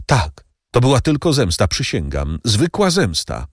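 slowing on the last words; anxious servant Bertuccio. A narrator's reading. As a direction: insist on your honesty to the count. Bertuccio swears at count's feet.

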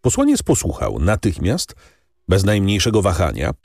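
ordinary delivery, nothing marked

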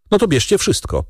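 high-pitched cry that drops away, not a full shout; exasperated outcry. A narrator's reading. Danglars gives up all his money to bandits, outcry. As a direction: cry out in surrender, starting high. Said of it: "krzyknął Danglars".

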